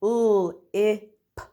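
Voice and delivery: each letter sound said separately, with pauses between. Shown as a demonstration. Teacher to pupils, sounding out a word letter by letter. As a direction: split the word into its single sounds for the class. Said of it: "my last word is".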